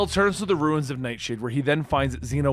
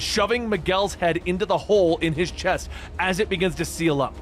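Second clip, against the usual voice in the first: plain reading voice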